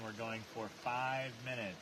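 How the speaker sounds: usual speaking voice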